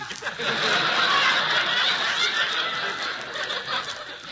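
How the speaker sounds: big audience laugh; audience laughter. Real, not acted. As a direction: let the crowd roar.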